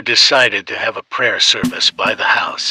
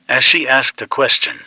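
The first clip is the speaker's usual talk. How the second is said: ordinary delivery, nothing marked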